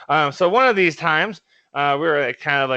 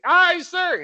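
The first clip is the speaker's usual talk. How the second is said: recruit shouting back. Retold or imitated. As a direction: snap it out like a recruit. Recruit answering drill instructor.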